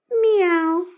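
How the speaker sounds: high little cat mew; the cat calling to the trapped children; cat meow. A narrator's reading. Cat meowing.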